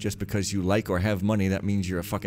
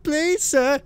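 put-on high-pitched pleading old beggar; crying beggar; 6 dB louder than usual. Beggar begging the rich novice, high pitiful cry. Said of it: "he cried".

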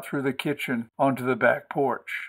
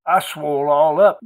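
put-on louder boy trying hard to sound country; put-on country accent; 3 semitones higher, 6 dB louder than usual. A narrator's reading. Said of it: "to sound country as he could".

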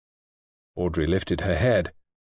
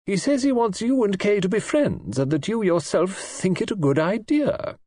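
put-on high old-lady voice with a scornful laugh; indignant old lady; 9 semitones higher than usual. A narrator's reading. Old lady scoffing at Neville's plan to her guest.

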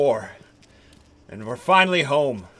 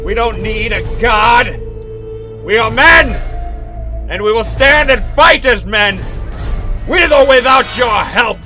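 defiant proclamation; 8 semitones higher, 7 dB louder than usual. Acted. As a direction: proclaim it to the heavens. Defiant speech to God.

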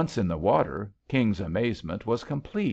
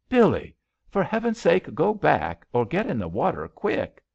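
high, louder panicked urging; alarmed urging; 7 semitones higher, 4 dB louder than usual. Acted. King urges Billy to hide her suit, alarmed. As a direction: hurry her out of sight, alarmed.

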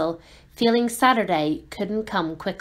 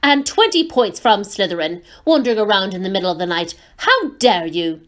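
stern scolding; 5 dB louder than usual. Acted. McGonagall scolding Malfoy.

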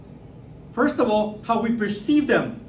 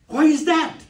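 higher, louder acted demand; retold challenge; 6 semitones higher, 5 dB louder than usual. Girlfriend questioning his change of accent, challenging. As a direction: imitate her pressing for an answer.

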